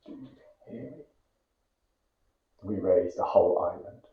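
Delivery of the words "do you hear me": hushed, much softer whisper; whispered refrain of a poem. Acted. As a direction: barely breathe the refrain.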